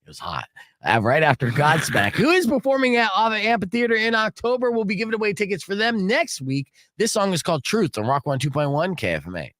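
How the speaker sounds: radio announcer's song intro; radio host tease. Real, not acted. Host teasing what's coming and introducing a song, radio DJ style.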